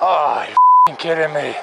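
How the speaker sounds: loud exasperated outburst; frustrated yell. Real, not acted. Sudden setback, frustrated outburst.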